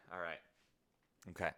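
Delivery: ordinary delivery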